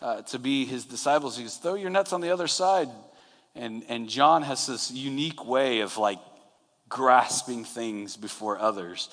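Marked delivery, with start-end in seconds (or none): 1.6-2.9 high retold call; lightly imitated holler. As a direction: project an instruction across the water. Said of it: "Jesus calls out to them".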